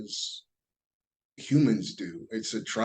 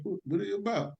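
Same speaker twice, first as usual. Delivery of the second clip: pressing; 9 semitones higher, 6 dB quieter, faster than usual. Retold or imitated. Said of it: "I was like".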